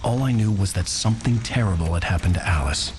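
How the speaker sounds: steady voice-over telling; story narration. A narrator's reading. Writer narrates waking in the crashed car, measured voice-over.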